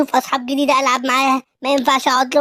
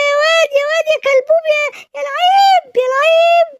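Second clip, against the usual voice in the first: much higher, squealing with glee and laughter; joyful high cheering; 13 semitones higher, 4 dB louder than usual. Acted. Kid cheers over his dad's car, high gleeful shouts. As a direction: cheer wildly like a kid in love with his toy.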